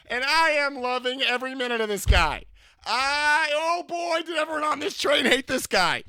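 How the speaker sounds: high, loud, delighted telling; excited. Real, not acted. Telling the subway story, high and loud with glee. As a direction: gush about loving every minute.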